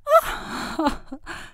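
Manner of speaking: high, scornful laugh; mocking fairy laugh. A narrator's reading. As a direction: laugh at him as a wily fairy. Fairy laughs at the angry king.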